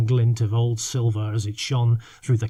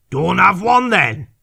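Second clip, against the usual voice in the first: louder, higher slow snap; snapped; 8 semitones higher, 8 dB louder than usual. Acted. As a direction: bite the words off in irritation. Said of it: "snapped the captain".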